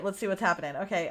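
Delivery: ordinary talk, nothing marked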